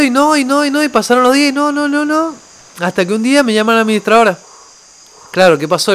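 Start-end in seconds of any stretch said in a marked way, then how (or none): 0.0-2.3 high, repeated refusals piling up; mock endless squabble, pitched up; retold stubborn refusal. Retelling days of arguing with the cook, high insistent refusal.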